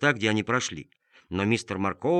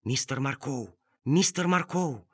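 muffled woman's call; 4 semitones higher, slower than usual. Acted. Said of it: "раздался придушенный голос".

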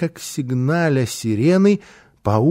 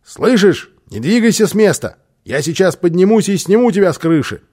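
loud, raised-pitch order; urgent warning from a distance; commanding; 6 semitones higher, 6 dB louder than usual. A narrator's reading. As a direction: order someone firmly to freeze. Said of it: "приказал он малышу".

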